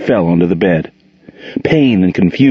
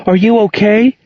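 fearful; 13 semitones higher than usual. A narrator's reading. Angel asking Gerald fearfully after the punch. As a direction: ask in alarm. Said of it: "she asked fearfully".

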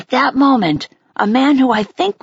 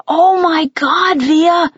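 drawn-out, higher-pitched excited exclamation; excited scream; 5 semitones higher, 3 dB louder, slower than usual. A narrator's reading. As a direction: gush with amazement, scream held back. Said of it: "Mom was screaming with excitement".

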